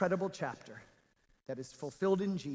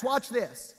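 ordinary talk

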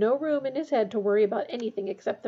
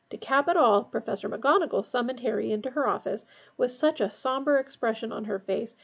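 ordinary delivery

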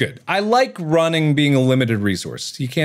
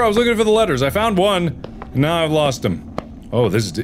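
normal talking voice

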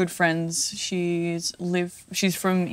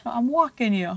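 higher-pitched put-on New York accent; 5 semitones higher than usual. Shown as a demonstration. Answering whether there is a New York accent, put-on New York accent. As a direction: play a New Yorker with a strong local accent. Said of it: "is there a New York accent".

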